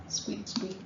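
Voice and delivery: very high, soft whispered squeaks; mouse squeak imitation. Shown as a demonstration. Teacher repeating the mouse sound for students, high whispered squeak. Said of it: "Who makes that noise?".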